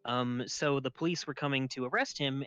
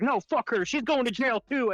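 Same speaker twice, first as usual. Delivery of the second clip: loud, raised imitation of a marshal; mock angry shout; 8 semitones higher, 5 dB louder than usual. Marshal leaving the building about the girlfriend, mock angry shout. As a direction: yell it in retold outrage on the way out. Other marshal yelling as he leaves.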